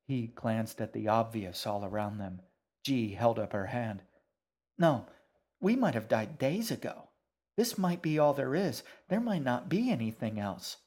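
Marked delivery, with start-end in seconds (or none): 4.8-10.8 put-on higher, insistent girl with swooping stresses; frustrated. A narrator's reading. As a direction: argue it out with raised pitch. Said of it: "She clenched her fists in frustration".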